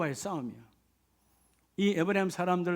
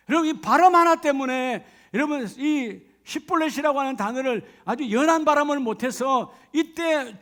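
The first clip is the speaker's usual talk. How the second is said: loud, rising preacher's climax; full-force sermon, loud and urgent; fervent preaching; 5 semitones higher, 6 dB louder than usual. Acted. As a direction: drive the point home from the pulpit.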